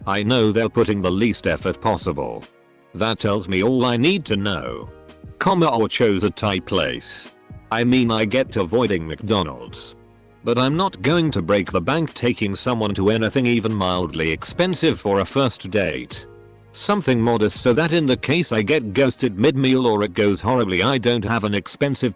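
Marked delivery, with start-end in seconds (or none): none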